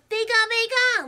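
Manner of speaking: high, urgent creature squeak; Pikachu. Acted. Creature trying to warn the girl, urgent squeak. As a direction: squeak it to get the point across. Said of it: "to get the point across".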